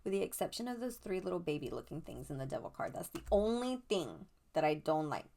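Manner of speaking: normal talking voice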